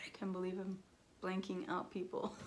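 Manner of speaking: ordinary talk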